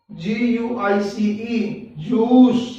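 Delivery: normal talking voice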